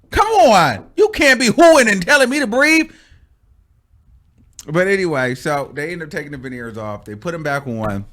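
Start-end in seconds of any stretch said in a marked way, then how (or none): 0.1-2.9 loud, high indignant rant; mock scolding. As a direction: tell off the jumpy best friend. Scolds friend in retelling.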